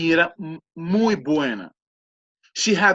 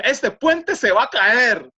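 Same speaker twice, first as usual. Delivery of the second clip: higher, slow, insistent mock alarm; alarmed warning; 5 semitones higher, 4 dB louder, slower than usual. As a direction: act out warning someone of danger. Her warning retold in Spanish. (aviso alarmado imitado)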